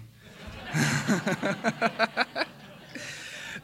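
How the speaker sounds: long high-pitched laugh; real laugh. Real, not acted. Speaker joking that meetings ruined his mom, long laugh. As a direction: laugh at your own joke.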